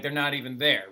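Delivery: usual speaking voice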